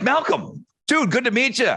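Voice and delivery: high-pitched delighted hello; retold thrilled first meeting; excited greeting. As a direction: greet him like a starstruck fan.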